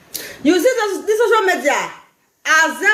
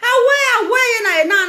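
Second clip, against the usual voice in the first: excited call; 9 semitones higher, 4 dB louder than usual. Real, not acted. Reacting to live comments.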